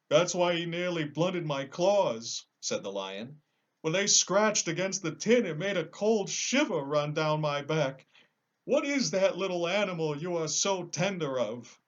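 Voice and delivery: a big cowardly lion protesting; lion, high and loud. Acted.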